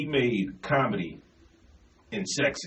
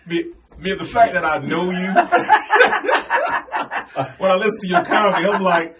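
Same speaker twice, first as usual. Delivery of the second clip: loud, high talk bursting into laughter; laughing hard; 8 semitones higher, 9 dB louder than usual. Real, not acted. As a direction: crack up helplessly between phrases. Host cracking up over guest's comedy.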